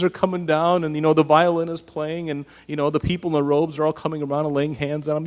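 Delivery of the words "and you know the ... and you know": ordinary talk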